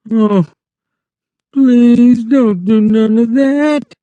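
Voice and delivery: high, slow desperate plea; Lenny begging in fright. A narrator's reading. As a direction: beg her to stop screaming. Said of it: "he begged".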